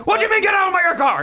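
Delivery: very high, fast laughing shout; mock Louis shouting. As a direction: yell at the Uber driver. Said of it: "He loses".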